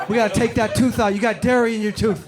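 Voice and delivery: loud, confident dentist pitch; scammy dentist. Retold or imitated. Dentist pushing a fake diagnosis, loud and confident.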